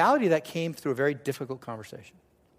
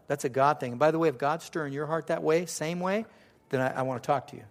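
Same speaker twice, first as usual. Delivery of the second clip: normal talking voice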